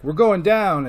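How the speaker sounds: high, loud cry of alarm; panicked yell. Retold or imitated. Pilot yelling to Duke, loud panicked cry. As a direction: shout that the plane is going down. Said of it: "he's all like".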